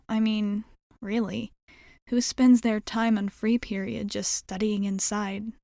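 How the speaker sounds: ordinary talk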